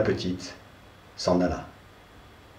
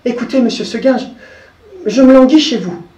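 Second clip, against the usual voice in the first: higher, hesitant little goat voice; goat pleading; 8 semitones higher than usual. Acted. The goat telling Seguin she is bored, higher and hesitant. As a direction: shyly confess she is unhappy. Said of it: "lui dit dans son patois".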